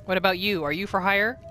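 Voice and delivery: ordinary talk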